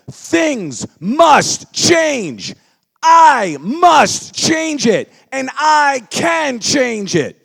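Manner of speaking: high, loud slow shout; loud together. Shown as a demonstration. Speaker with the standing crowd, loud slow shout. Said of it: "let's say it out loud together".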